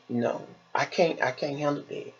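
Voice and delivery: ordinary talk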